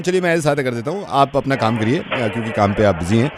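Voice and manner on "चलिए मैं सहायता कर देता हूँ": ordinary talk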